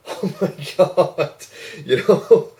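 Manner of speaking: high, drawn-out mock stifled laugh; restraining laughter. Teller reliving the rude phone remark, restraining laughter. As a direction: act out holding back laughter, high and loud. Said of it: "trying to restrain my laughter".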